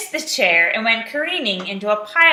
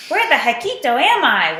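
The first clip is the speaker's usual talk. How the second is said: louder, higher puzzled question; wondering aloud; 4 semitones higher, 5 dB louder than usual. A narrator's reading. Skipito arrives at a strange shack, wondering aloud. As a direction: wonder where on earth you are. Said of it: "wondered Skipito aloud".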